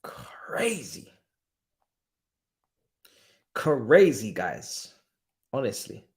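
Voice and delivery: whispered in disbelief; stunned whisper. Real, not acted. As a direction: breathe the word out, stunned. Stunned after a long silence.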